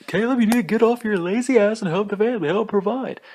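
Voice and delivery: high, loud scolding mother; hammed-up shrill parent scolding; nagging parent voice. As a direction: mimic the nagging mom.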